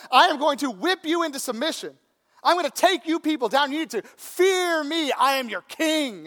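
high, loud threatening king; roaring tyrant king. Retold or imitated. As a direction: bellow threats at your subjects.

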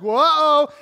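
loud jolted yelp; startled whoa. Retold or imitated. Man shocked awake by the alarm, loud and startled. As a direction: jump out of sleep.

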